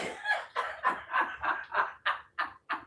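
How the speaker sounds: high burst of laughter, then wheezing; breathless laugh. Real, not acted. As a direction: burst out laughing and let it trail off. Laughs at dogs story.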